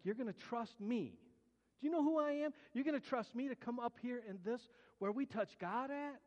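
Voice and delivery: mock offended pride, pitch up high; proud indignant attitude. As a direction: act insulted to be trusted with it. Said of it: "That, that was my attitude".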